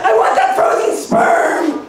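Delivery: slow, breathy pleading demand; mock demanding scientist. Scientists begging by letter, slow mock plea. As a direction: beg for it like a greedy scientist.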